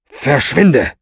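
loud, curt dismissal; gruff snap. A narrator's reading. Oz chases off the maid by the kitchen, snapping. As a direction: snap at her to get lost. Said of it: "schnauzte Oz sie nur an".

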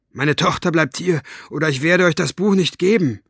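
loud, firm ultimatum; snapped. A narrator's reading. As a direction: snap back to protect the daughter. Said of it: "fuhr Mo ihn an". (laut und entschieden angefahren)

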